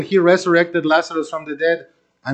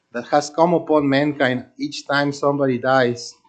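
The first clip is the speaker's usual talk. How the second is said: usual speaking voice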